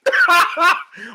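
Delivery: long high real laugh. Real, not acted. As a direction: burst out laughing at the absurd list. Laughing at the ranking.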